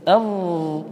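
prolonged, steady buzzing ظ after a short vowel; flowing ظاء demo. Shown as a demonstration. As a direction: hold a long voiced ظ buzz. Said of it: "اسمعوا إلى الظاء".

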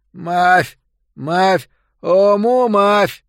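loud calling, slurred consonants; lipless mouth voice. A narrator's reading. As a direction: hawk the ointment without lips. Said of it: "повторяя".

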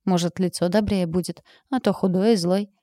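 ordinary delivery, nothing marked

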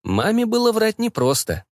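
ordinary delivery

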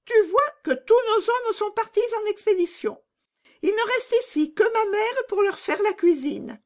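put-on very high squeaky child conspirator; scheming robber girl. Robber girl promising Gerda help, very high and eager. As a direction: lay out the plan in a little bandit's voice.

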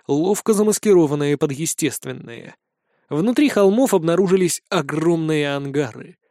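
ordinary delivery, nothing marked

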